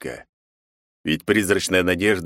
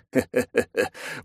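villain chuckle; 4 semitones higher, 4 dB quieter than usual. Acted. Villain chuckles at his plan.

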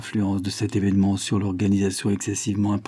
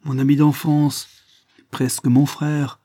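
high, slow, horrified disbelief; shuddering; 6 semitones higher, 6 dB louder, slower than usual. A narrator's reading. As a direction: dwell on each phrase, appalled. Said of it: "en frémissant".

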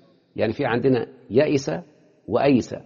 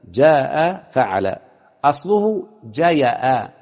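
slow demo; 3 dB louder, much slower than usual. Shown as a demonstration. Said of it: "teacher drawing out the pattern".

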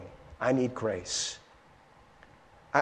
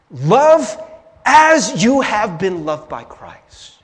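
Love punched high and loud, the rest slow; preached emphasis; 4 semitones higher, 6 dB louder, much slower than usual. Real, not acted. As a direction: land the command like a pulpit climax. Preacher stresses the command.

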